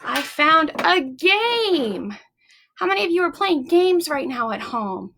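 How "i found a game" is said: slow, deliberate show-and-tell; slow teacher reveal. Shown as a demonstration. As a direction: draw it out for the kids.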